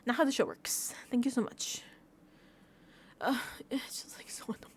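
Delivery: whispered, fading frustration. Real, not acted. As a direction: drop to a whisper of exasperation. Muttering frustration to herself.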